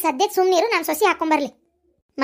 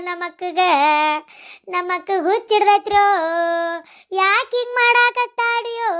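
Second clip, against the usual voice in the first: theatrical lament; 3 semitones higher than usual. Acted. Younger sister bewailing gold going to daughter-in-law, theatrical lament. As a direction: wail it out like a hammed-up dirge.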